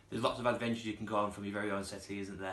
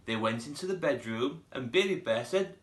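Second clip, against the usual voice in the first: usual speaking voice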